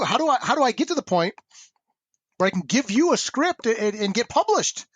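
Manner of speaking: high-pitched, insistent appeal; retold earnest plea. Young writer appealing to an editor, earnest plea. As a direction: plead your case, pitch climbing.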